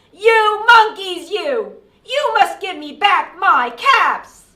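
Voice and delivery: very loud, high storybook shout; angry shout. A narrator's reading. Peddler stamping both feet at the monkeys, shouting. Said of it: "He stamped both his feet and shouted".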